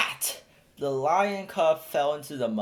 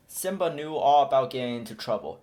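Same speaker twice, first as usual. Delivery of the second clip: usual speaking voice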